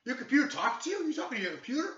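high, loud, incredulous cop imitation; mock interrogating cop. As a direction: mimic an officer loudly grilling someone. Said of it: "kept basically interrogating me".

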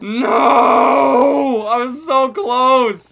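loud, high, drawn-out cry; frustrated shout. Real, not acted. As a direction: yell out the near miss. Just missed the kill.

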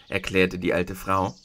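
ordinary delivery, nothing marked

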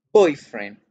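put-on boyfriend with a loud stress on boy. Shown as a demonstration. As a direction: punch boy, say it as one word. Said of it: "hago el acento en boy".

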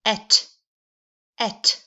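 teacher modeling a nonsense syllable; sounding out et. Shown as a demonstration. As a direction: pronounce "et" with a clear short e and crisp t. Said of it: "The sound is".

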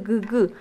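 "g" repeated as an isolated hard g; pulsed hard g for a learner; letter-sound demo. Shown as a demonstration. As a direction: sound out "g, g", not the letter name. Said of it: "¿Qué letra hace g".

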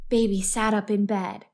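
plain reading voice

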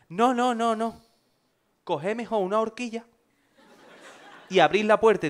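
high, loud protesting voice; objecting friend. Retold or imitated. Friend Alberto protests, high and loud, use a hairpin instead.

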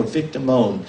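usual speaking voice

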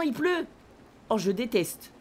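normal talking voice

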